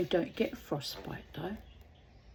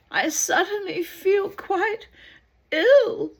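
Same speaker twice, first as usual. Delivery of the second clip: high complaint; 12 semitones higher, 9 dB louder than usual. Acted. Diseased man complains.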